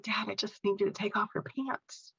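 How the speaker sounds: very soft, gentle request; tender plea. Retold or imitated. Daughter answering dad in a tender moment, softly pleading. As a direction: ask softly and patiently.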